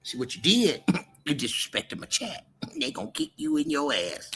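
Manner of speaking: ordinary delivery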